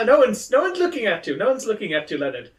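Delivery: high, gentle coaxing with a laugh breaking in; calm the scared friend, sing-song; soothing Leonard. Acted. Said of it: "being like".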